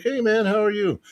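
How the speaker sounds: high-pitched, chummy retold hello; cheerful greeting. Traveller greeting the border guard, cheerful. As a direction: replay your cheerful greeting, pitched well up.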